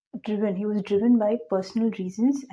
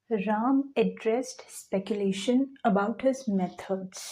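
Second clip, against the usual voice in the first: 1 very slow read-aloud; much slower than usual. Shown as a demonstration. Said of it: "teacher reads the next sentence".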